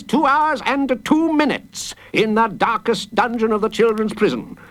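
high, grandly pompous sentencing; gleeful judge. A narrator's reading. Judge passing sentence on the boy, gleefully pompous. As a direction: relish each item, pitched high. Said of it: "rubbing his hands together gleefully".

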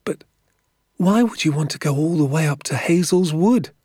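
put-on high, incredulous child's voice; puzzled young boy. A narrator's reading. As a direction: ask as a baffled small boy. Narrator voices the boy Danny.

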